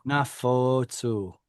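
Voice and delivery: Nafotsu drawn out slowly, stress on the holam "fo"; slow model pronunciation. Shown as a demonstration. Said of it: "that's a holam that is stressed".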